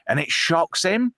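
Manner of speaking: ordinary talk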